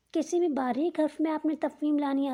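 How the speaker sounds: normal talking voice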